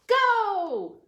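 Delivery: loud high cheer; shout. Acted. Reader shouting go to start the demolition. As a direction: launch the swing with a yell.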